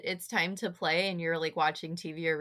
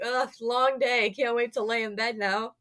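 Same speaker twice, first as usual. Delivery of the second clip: lazy dog; 3 semitones higher, 3 dB louder than usual. Retold or imitated. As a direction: drag it out like a worn-out pet. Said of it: "and you're like".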